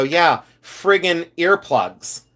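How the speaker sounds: slow, drawn-out reply; retold order, dragged out slowly; deadpan drive-thru order. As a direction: stretch each word out for emphasis. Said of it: "I said it just like that".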